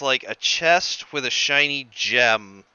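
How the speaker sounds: normal talking voice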